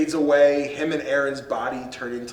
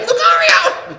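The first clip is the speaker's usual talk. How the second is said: much higher, loud drawn-out cry of the name; anguished mock cry; 9 dB louder, slower than usual. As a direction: howl the name as your hero falls. Cries out to dying Pokémon.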